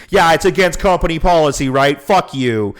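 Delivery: sarcastic repeat, then a curt fuck you; mocking parrot. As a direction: throw her words back at her with contempt. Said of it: "He goes".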